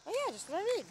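high, exaggerated sarcastic swoop. Shown as a demonstration.

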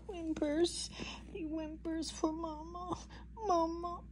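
tiny, very soft mock whimper. Baby llama wants his mama, softly whimpering. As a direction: whimper it, barely voiced. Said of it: "he's whimpering".

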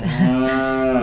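short, low acted chuckle; low put-on laugh; mocking laugh. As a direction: laugh scornfully as a storybook brother. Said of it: "Blue laughed".

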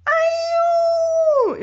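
very high, loud shriek; scream. A narrator's reading. Girl sledding down the slope, shrieking very high and loud. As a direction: shriek with fear and fun. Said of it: "hurla Bérénice".

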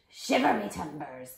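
loud pirate exclamation; hammed-up pirate captain; storybook pirate. A narrator's reading. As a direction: exclaim like an old sea dog. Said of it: "the pirate said".

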